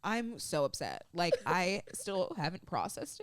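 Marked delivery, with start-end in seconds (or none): none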